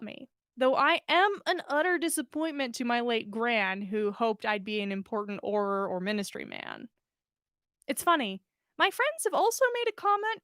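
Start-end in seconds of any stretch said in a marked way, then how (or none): none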